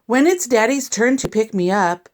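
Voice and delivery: usual speaking voice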